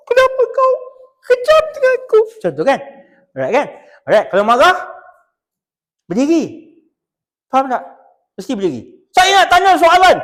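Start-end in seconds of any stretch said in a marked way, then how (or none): 0.1-2.2 high, loud melodramatic lament; mock sad wail. Shown as a demonstration. Lecturer demonstrating sadness, hammed-up wail. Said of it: "kalau kita bersedih". 9.1-10.2 high, loud demanding bark; mock angry customer. Angry customer at the inquiry counter, mock demand.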